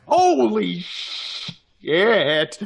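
drawn-out, very slow expletive in shock; stunned. Real, not acted. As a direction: stretch each word out in disbelief. Player takes a huge hit.

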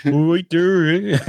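high, slow Canadian drawl; mock Canadian accent. Host spoofs Brits' attempts at Canadian, mock Canadian accent. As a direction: put on a hammed-up Canadian accent. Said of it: "especially when they do Canadian".

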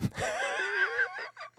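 high, far-off squealing cartoon laugh. Real, not acted. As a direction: let out a high toony laugh. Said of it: "That was my cartoon laugh".

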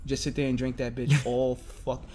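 ordinary talk

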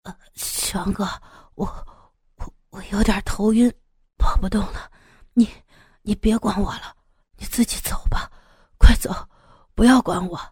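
half-whispered, wounded gasps; begging weakly between breaths; feeble, breathless pleading. Acted. Wounded sidekick urging his brother to flee, feebly gasping. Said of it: "嘴里一边有气无力地说".

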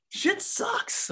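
very high, strained complaint; frustrated, voice way up; exasperated protest. Retold or imitated. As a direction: vent it in an acted, high-pitched outburst. Acted protest at being comforted.

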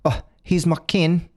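hammy Australian twang; Australian accent. Pretend. Friend trying the kin joke in an Australian accent. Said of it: "That's Australian".

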